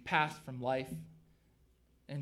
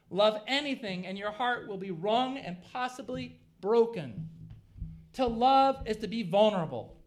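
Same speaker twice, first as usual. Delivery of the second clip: loud, higher-pitched sermon delivery; forceful preaching; 7 semitones higher, 7 dB louder than usual. Real, not acted. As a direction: drive each phrase home to the congregation.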